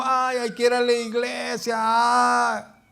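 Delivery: voz de feligrés exagerada, aguda y a gritos; imitación burlona. Retold or imitated. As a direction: se burla del que solo piensa en ir al templo. Imita a feligrés que malinterpreta. (voz burlona y chillona)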